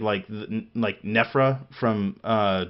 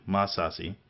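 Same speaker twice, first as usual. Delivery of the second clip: normal talking voice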